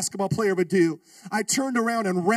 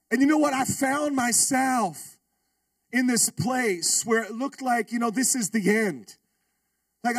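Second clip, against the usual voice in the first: sermon build; 5 semitones higher than usual. Acted. Preacher turning the story to the congregation, earnest.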